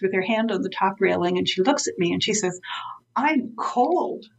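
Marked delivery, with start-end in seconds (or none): 3.1-4.2 lightly voiced elderly woman, higher and a touch plaintive; Mary complaining. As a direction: mildly imitate the old client noticing the cold. Mary complains outside in snow.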